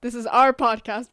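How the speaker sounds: loud, proud announcement. Real, not acted. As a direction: belt out the claim. Loudly claiming the podcast.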